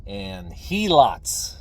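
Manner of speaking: usual speaking voice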